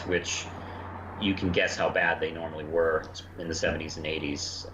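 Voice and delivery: ordinary talk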